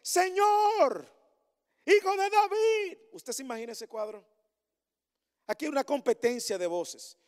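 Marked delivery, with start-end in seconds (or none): 0.0-3.0 very high, loud desperate cry; a blind beggar's straining cry over the crowd; crying out louder. Acted. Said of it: "gritaban más aún".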